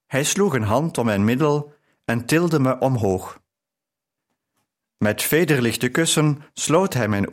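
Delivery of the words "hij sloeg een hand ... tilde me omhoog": usual speaking voice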